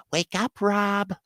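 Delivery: high, slow imitated girlfriend; imitating a sweet-voiced woman; sweet little voice. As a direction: coo the wake-up call high and drawn out. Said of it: "she had this sweet little fucking voice".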